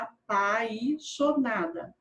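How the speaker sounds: "apaixonada" slowly, in parts, with a clear I in "pai"; full "ai" sound. Shown as a demonstration. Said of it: "escrevam aí na letra A". ("apaixonada" devagar, com o I de "pai" bem claro)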